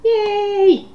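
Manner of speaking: high sarcastic yay; mock cheer. The co-host mocking honest reviews with a high yay. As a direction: squeal a mock celebration.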